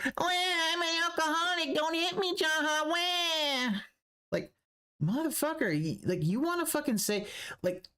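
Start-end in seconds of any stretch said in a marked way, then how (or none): 0.0-3.8 high whiny mock crying voice; sniveling coward pleading not to be hit; mock crybaby whine. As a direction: whine it like a scared crybaby, pitched way up. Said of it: "running away like".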